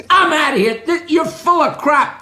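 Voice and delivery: high-pitched, fed-up customer; mock indignation. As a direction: protest as if you've been ripped off. Fed-up customer in joke storms off.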